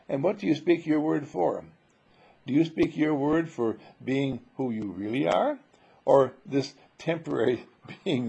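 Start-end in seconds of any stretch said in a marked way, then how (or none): none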